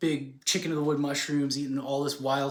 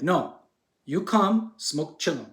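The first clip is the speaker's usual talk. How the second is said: insistent sage; 3 semitones higher, 4 dB louder, slower than usual. Retold or imitated. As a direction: play the sage firmly repeating his invitation, word by word. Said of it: "And then he's like".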